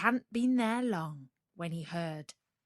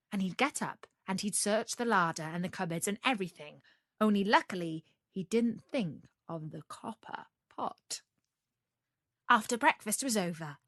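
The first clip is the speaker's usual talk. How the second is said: usual speaking voice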